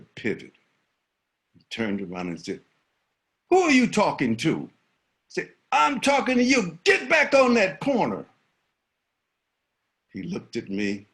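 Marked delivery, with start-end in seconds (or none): 3.5-4.7 put-on louder, higher tough-kid retort; defiant challenge. Bully challenges crossing guard. 5.7-8.3 high, loud repeated order; yelling. Retold or imitated. Crossing guard yells again at bully.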